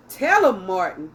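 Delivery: a loud, rising call from behind the podium; shouted encouragement. Acted. [name] calling out to [name] mid-speech, a loud urging shout. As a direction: urge him on, full voice. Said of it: "she shouted".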